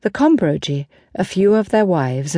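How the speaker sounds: ordinary delivery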